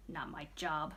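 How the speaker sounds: low, soft grumble under her breath; grumbled. A narrator's reading. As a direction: grumble it, put out and sour. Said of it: "she grumbled".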